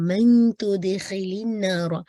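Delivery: slow recited "मन तुदखिलिन नारा"; tajweed recitation. Shown as a demonstration. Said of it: "nasal sound के अंदर".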